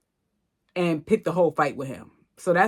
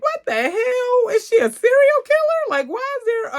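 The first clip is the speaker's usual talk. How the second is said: shocked disbelief; 5 dB louder than usual. Retold or imitated. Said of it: "I'm like".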